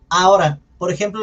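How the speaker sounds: ordinary talk, nothing marked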